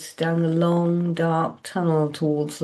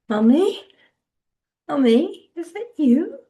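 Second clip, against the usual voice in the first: put-on high, lost little girl voice; childlike voice; 11 semitones higher, 5 dB louder than usual. A narrator's reading. Dazed child calling mother.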